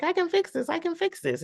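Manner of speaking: high, eager lady voice; eager wife. Retold or imitated. Wife reassuring her husband, high and eager. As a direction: pipe it up brightly and eagerly.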